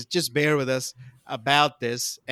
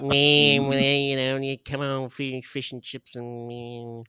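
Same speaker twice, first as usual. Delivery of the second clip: mocking Kiwi accent; slower than usual. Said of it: "you guys with your".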